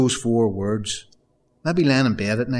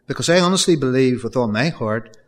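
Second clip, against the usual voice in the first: normal talking voice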